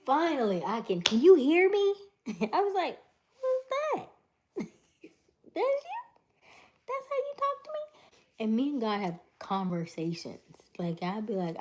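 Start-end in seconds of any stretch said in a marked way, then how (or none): 0.1-1.9 high, loud squeaky voice of God; God booming back in a silly high pitch. Retold or imitated. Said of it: "I felt like God was like". 3.4-8.0 high, laughing disbelief; shocked. Retold or imitated. Shocked reaction to God's voice.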